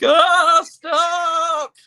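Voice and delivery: high, loud mock protest; exasperated shriek, acting out a friend; imitated annoyed yell. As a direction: cry out as if creeped out. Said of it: "And he'd be like".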